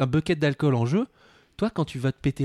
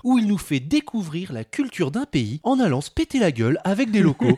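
lively, higher-pitched presenter read; punchy scripted podcast introduction; show intro read; 4 semitones higher than usual. Acted. Host introducing guest Alban to listeners, presenting.

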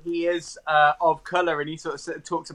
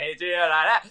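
garbled mock Scottish mumble; nonsense-sounding Scottish impression; unintelligible Scottish accent. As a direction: run the words together in imitated Scottish. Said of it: "Like that, and they just no sense".

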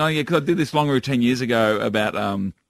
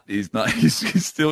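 usual speaking voice

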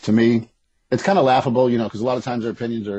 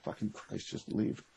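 whispered under the breath, exasperated; muttered curse; 14 dB quieter, slower than usual. Retold or imitated. Muttering at slow shoppers in the supermarket, whispered. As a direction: hiss it to yourself at the slow shoppers. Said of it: "I'm like".

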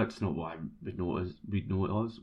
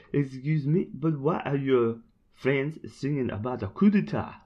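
lightly put-on French accent, a bit higher; 5 semitones higher, 3 dB louder than usual. Woman near the Eiffel Tower asking the fans, in a light French accent. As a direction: play the puzzled French woman. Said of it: "try a French accent here".

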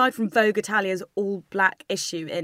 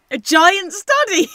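incredulous; 10 semitones higher, 9 dB louder, slower than usual. Real, not acted. Mocking phrase just read out.

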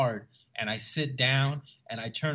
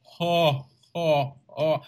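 drawn-out, louder "ho" in mock slow motion; slow-motion laughter; 4 semitones higher, 4 dB louder, much slower than usual. Bombed comic hears audience laughing, imitated slow-motion guffaws. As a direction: imitate a crowd's laugh slowed right down. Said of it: "It was like slow motion".

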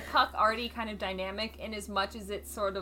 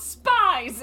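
sharp yell, higher and louder; 8 semitones higher, 10 dB louder than usual. Retold or imitated. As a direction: shout it like an accusation. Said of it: "And then she just yells".

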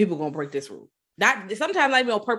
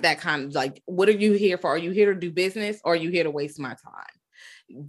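ordinary delivery

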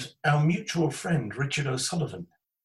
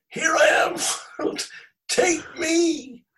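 very high, loud imitated holler; mountaintop shout; 7 dB louder, much slower than usual. Storyteller as naked friend on the mountaintop, high shout. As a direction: bellow it to the world at a high pitch.

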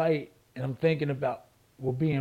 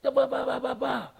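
mock cussing; 9 semitones higher than usual. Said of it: "So I started cussing".

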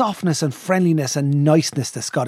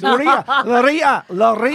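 put-on higher, teasing Scottish accent; mock Scottish accent; 5 semitones higher, 4 dB louder than usual. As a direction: mimic the Scottish accent to tease.

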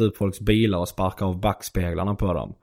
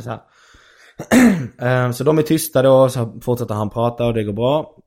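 normal talking voice

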